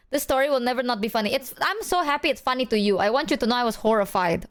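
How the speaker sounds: usual speaking voice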